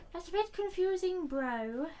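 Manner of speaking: loud baffled outburst at the screen; loud exclamation. Real, not acted. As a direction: shout the line again in disbelief. Repeats the line much louder.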